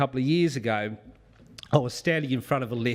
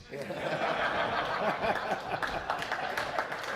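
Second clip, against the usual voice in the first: 1 laugh; 7 semitones higher, 5 dB quieter than usual. Real, not acted. Laughs at his own punchline.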